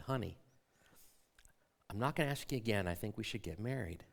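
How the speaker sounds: low, soft and very calm. Retold or imitated. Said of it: "I lowered my voice, and I said very calmly".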